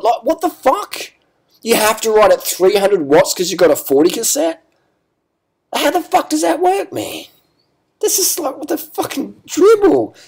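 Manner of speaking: very high, incredulous ranting; exasperated disbelief. Real, not acted. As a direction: pitch it way up, baffled and fed up. Exasperated at absurd gearing logic.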